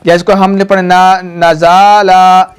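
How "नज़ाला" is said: नज़ाला slow with the vowels stretched; wrong drawn-out reading. Shown as a demonstration. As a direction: stretch नज़ाला lazily to show the error. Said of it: "ये भी गलत है".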